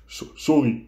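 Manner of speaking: haperend, licht gespeeld snikken; snikkend. A narrator's reading. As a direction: snik een verontschuldiging eruit. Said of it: "snikte Hagrid". (voorgelezen snik met gestotterde inzet)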